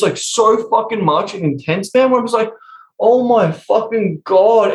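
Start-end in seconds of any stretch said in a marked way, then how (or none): 3.0-4.7 exasperated groan. Retold or imitated. Exasperated at spiritual drama.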